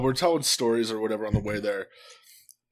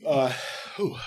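normal talking voice

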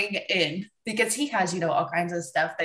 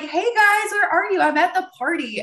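put-on chirpy ad girl; 10 semitones higher than usual. Retelling a car ad's girl who calls friends, high innocent chirp. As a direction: play the sweet girl faking an alibi. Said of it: "it's just like".

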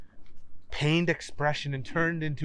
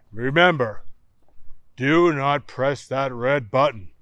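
louder, slow, grave astronaut voice; firm warning; 6 dB louder than usual. Acted. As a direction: lay down the rule firmly. Chet's stern warning.

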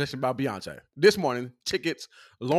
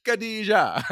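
mock Jamaican accent; 9 semitones higher, much slower than usual. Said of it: "terrible Jamaican accent".

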